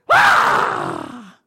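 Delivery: a loud bursting howl of crying; loud wail. Retold or imitated. Pastor showing the congregation how hard he cried, loud howl. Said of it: "而且还使劲的大哭".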